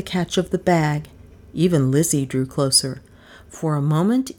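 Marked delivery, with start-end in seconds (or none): none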